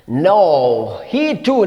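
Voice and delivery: ordinary delivery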